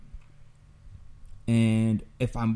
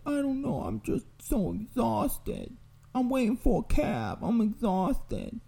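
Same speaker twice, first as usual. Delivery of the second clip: put-on much higher, slow, weary little old lady voice; tired old lady; 11 semitones higher, 4 dB quieter than usual. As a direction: drag it out, worn out and high. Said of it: "She's like".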